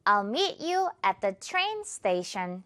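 very slow, every word clear; slow teacher model sentence; slow model read. Shown as a demonstration. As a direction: read it slowly for learners to copy. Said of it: "One more time".

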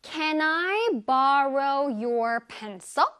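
Can I borrow your pencil? 을 다시 아주 천천히; slow model sentence. Shown as a demonstration. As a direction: repeat slowly for learners to copy. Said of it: "One more time". (다시 한 번 천천히 또박또박)

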